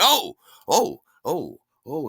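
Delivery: high loud exclamations, stepping down; imitated sudden realisation. Party host learning he handed over too little, loud surprised exclamations. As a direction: act out a startled host catching his mistake.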